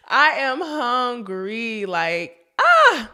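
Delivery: slow whine building to a loud cry; hungry wail. Real, not acted. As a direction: whine about hunger then burst out. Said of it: "I'm hungry".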